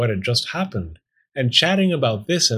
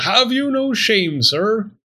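high, indignant cry; yelled; 10 semitones higher, 4 dB louder than usual. A narrator's reading. Talking bone shaming the fox, yelling. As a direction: scold in a high, forceful voice. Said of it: "the bone yelled".

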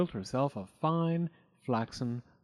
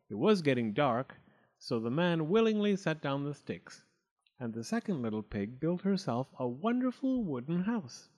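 usual speaking voice

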